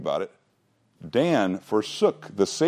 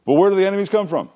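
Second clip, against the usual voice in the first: higher, fast, eager quiz question to the room; 10 semitones higher, 4 dB louder, faster than usual. Real, not acted. Teacher quizzing his Bible class, high and eager. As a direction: fire it at them like a quiz show host.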